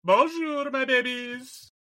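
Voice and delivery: high, drawn-out French greeting; playful French. Pretend. Host greeting listeners with a mock-French flourish, high-pitched. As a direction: sing out a playful French hello. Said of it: "decided to go French".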